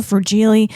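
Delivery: fragile said as fra-JEE-lee; playful mispronunciation. Shown as a demonstration. Vlogger cycling through ways to say fragile, playful mispronunciation. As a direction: end fragile on a sing-song "jee-lee". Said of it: "and that's fragile".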